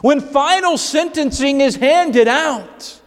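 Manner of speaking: high, driving preacher's voice, pressing each phrase home; full-force preaching. Acted. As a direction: preach it hard to the congregation.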